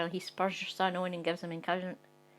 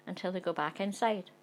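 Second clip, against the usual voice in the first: ordinary delivery, nothing marked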